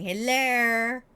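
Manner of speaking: put-on voice for a nagging bladder; drawn-out, mock attention-seeking hello; talking bladder. Bladder wakes the sleeper from a dream, mock insistent call. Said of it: "your bladder's going".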